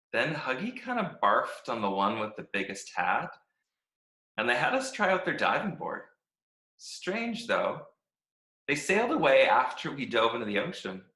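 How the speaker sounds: upbeat, chirpy diary reading; a happy-go-lucky stick who loves every minute; cheerful Stick. Acted. Stick reads his cheerful diary.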